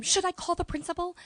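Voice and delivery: high, loud indignant threat; outraged colleague. Retold or imitated. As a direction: snap it like a stern teacher.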